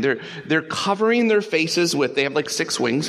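ordinary delivery

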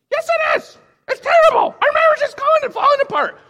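upset spouse. Retold or imitated. Spouse in counselling insisting the marriage fails, shrill mock panic. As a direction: ham up the panicking partner in counselling. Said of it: "the other one's like".

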